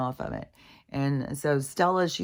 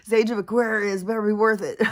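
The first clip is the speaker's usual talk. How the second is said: higher, louder wry demand; joking exasperation; 8 semitones higher, 6 dB louder than usual. Real, not acted. Speaker venting about getting through this, loud joking exasperation. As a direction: raise the voice in half-laughing protest.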